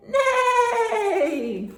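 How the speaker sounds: loud, high, falling whinny; long-drawn neigh sliding down; horse neigh. Acted. Storyteller as the horse rearing up, loud high neigh. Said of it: "Reared up and said".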